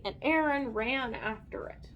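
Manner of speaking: ordinary delivery, nothing marked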